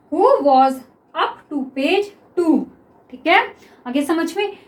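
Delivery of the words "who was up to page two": each word drawn out slowly; slow dictation. Shown as a demonstration. Teacher dictates the next sentence to students, very slow. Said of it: "तो क्या हो गया ये".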